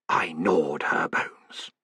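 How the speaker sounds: low, slow, soft growl; menacing monster rumble; growling creature. A narrator's reading. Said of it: "growled a voice".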